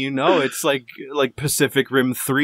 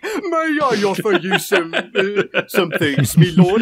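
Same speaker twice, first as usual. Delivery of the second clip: mock servant; 5 semitones higher, slower than usual. Servile butler offering weed.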